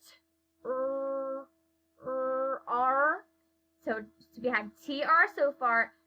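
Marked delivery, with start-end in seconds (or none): 0.0-3.2 T as a short puff, then a held R; slow phonics T, drawn-out R; sounding out. Shown as a demonstration. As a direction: sound out T and R separately, with pauses. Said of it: "say what sounds or the letters we hear".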